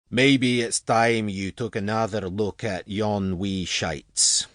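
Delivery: broad Scottish boss's voice; put-on Scottish accent. A narrator's reading. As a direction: nudge someone along in thick Scots. Voicing Percy, the Scottish boss.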